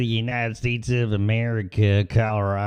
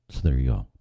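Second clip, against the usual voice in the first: ordinary talk, nothing marked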